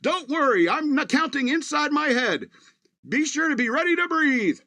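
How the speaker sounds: high, chirpy robot delivering bad news brightly; perky assistant announcing a heart attack; cheerful robot voice. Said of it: "this cheerful robot".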